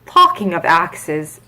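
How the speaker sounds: brusque Duchess, slowed right down; the Duchess barking a pun, slow. A narrator's reading. Narrator voicing the Duchess to Alice, slow and brusque.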